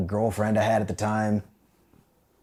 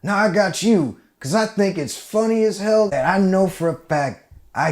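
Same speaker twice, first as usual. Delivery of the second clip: higher, louder smug taunt; smug sergeant; 7 semitones higher, 4 dB louder than usual. Retold or imitated. Sergeant gloating at the recruit, smug. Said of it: "And he goes".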